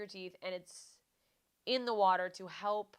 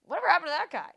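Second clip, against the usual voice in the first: higher, louder, baffled mock question; mock-puzzled cop, pitched up and loud; clueless cops; 9 semitones higher, 12 dB louder, much faster than usual. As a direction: play the cops who forgot all about him. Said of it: "they're like".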